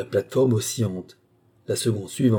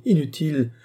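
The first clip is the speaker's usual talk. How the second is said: high, louder cry of terror; despairing cry; 7 semitones higher, 4 dB louder than usual. Acted. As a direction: she has seen death coming and cries out. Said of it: "cria Marie".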